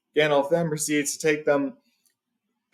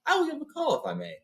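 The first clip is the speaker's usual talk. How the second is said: much higher, gentle asking; polite wizard; 3 dB quieter than usual. A narrator's reading. Gandalf asking Beorn's leave to call, polite and high. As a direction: ask leave with courtesy.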